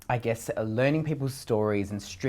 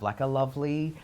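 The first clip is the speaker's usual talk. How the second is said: ordinary talk, nothing marked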